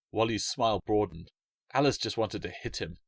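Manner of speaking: ordinary delivery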